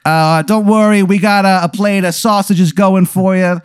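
put-on high Italian accent, slowing at the end; a cheery Italian host offers sausages. Alien offers food in a high Italian accent. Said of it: "he talks in an Italian accent".

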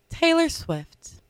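quiet, slow documentary narration; narrator voice. Acted. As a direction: whisper it like a nature documentary narrator. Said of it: "with my narrator voice".

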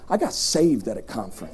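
low, soft confiding; hushed testimony. Real, not acted. Preacher telling crowd how he got saved, hushed. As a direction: drop right down and share it quietly. Quiet take before emphatic repeat.

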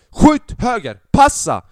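high, loud shout at a player; sideline shout. Retold or imitated. Football fan yells orders at player, loud shout. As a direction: bellow it from the stands.

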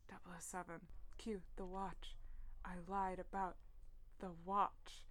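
weak, hushed near-whisper; barely able to speak. Acted. Injured Q tells Bond about the watch, barely able to speak. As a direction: murmur it softly as if badly hurt. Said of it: "Barely able to speak".

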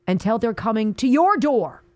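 ordinary delivery

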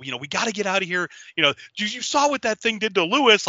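mock-panicked urgency, pitch climbing; freaking out. Group gearing up to escape the building, acted freak-out. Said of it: "they're freaking out".

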